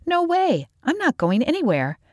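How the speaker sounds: put-on high, stubborn girl's voice; high-pitched, defiant refusal. A narrator's reading. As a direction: dig your heels in, pitched high. Callie refuses to leave.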